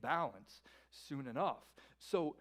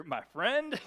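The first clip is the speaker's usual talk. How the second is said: self-satisfied friend; 3 semitones higher, 7 dB louder than usual. Acted. Rich man addresses himself.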